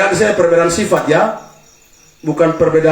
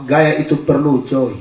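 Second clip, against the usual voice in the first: relaxed reply; 5 semitones lower than usual. Retold or imitated. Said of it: "dengan santai menjawab".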